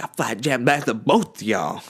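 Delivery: a changed voice throwing out a soliloquy line; stage performance voice. Pretend. Onstage for the class, he recites a dramatic line in a changed voice. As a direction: perform it like you're going all out.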